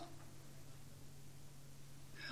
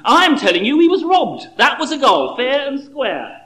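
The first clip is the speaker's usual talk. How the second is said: loud, higher, fast then slowing to fair and square; heated insistence; 4 semitones higher, 6 dB louder than usual. Acted. John insisting to Bill the goal stood, heated. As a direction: insist he was robbed. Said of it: "retorted John".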